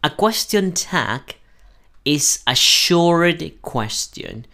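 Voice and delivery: very slow, careful English read-aloud; teacher reading the rule slowly. Shown as a demonstration. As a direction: read the definition word by word. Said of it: "Dice así".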